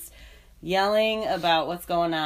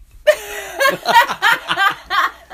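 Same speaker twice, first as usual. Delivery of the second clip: loud, high burst of laughter; 11 dB louder than usual. Real, not acted. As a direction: crack up loudly.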